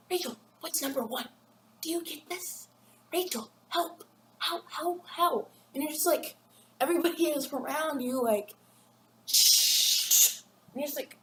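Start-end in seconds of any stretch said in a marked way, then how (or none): 0.1-5.5 higher, hammed-up begging for help; mock panicked plea. Pleading with friend in class. 9.3-10.4 sharp, voiceless shush. Retold or imitated. Classmates hushing you.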